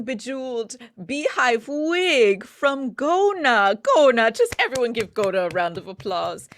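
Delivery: host [name] opening his showcase, theatrically. Acted. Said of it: "it was very theatrical".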